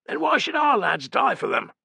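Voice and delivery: high, strained cry of protest; cried out. A narrator's reading. Optio crying out against sacrificing the lads. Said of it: "cried Fenestella".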